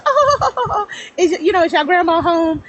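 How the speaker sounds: put-on high sweet old aunt voice; a light imitation of a great aunt; great aunt asking. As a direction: ask politely like a great aunt on the phone. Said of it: "She was just".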